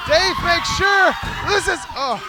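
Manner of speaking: lively ringside play-by-play; wrestling commentator. Real, not acted. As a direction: call the match as it happens.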